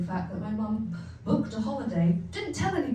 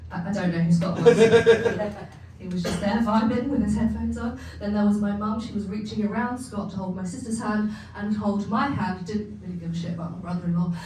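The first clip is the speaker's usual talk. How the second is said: usual speaking voice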